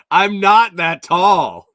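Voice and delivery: laughing fake outrage at chat; mock protest. Real, not acted. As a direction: play offended at the teasing. Mock protest at chat about height.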